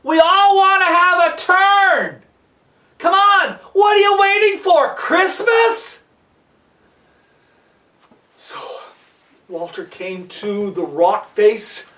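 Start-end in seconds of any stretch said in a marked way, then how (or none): 0.0-6.0 high, impatient holler; Mario yelling down. Acted. Mario hurrying Walter along, impatient yelling. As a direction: heckle from above in a raised, stretched yell. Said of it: "Mario yells down".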